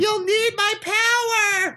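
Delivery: drawn-out, very high mock wail; imitated desperate scream. Mocking a film character passing on powers, melodramatic scream. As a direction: scream it out slowly, hammed up.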